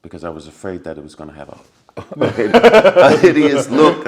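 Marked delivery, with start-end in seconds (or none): 2.0-4.1 louder, breaking into a real laugh; real laugh spilling over the words. Real, not acted. Laughing at his fear.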